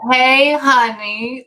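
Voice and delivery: imitated woman's singsong hello, big and slow; woman's voice calling. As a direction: stretch it right out, calling to a sweetheart. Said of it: "like that".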